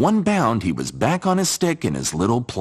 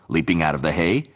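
plain reading voice

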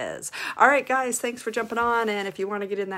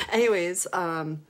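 ordinary talk, nothing marked

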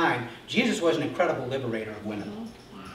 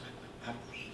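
awed confession; 5 semitones lower, 21 dB quieter than usual. Acted.

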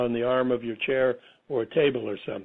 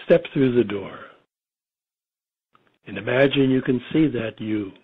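guided meditation; 5 semitones lower than usual. Real, not acted. Guiding listeners into a relaxing state, meditation voice. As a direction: lull them gently, phrase by phrase, leaving space between.

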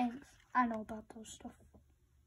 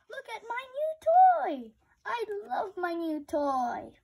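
much higher, louder bragging about a toy; excited show-off; 7 semitones higher, 6 dB louder than usual. Acted. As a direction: proudly show off the new toy. Piggy shows off new toy.

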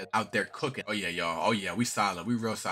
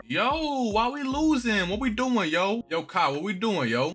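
high, raised-voice pleading complaint; exasperated; 10 semitones higher, 4 dB louder, slower than usual. Real, not acted. Gamer asking Kai why they're losing, exasperated. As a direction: wail at your team in frustration.

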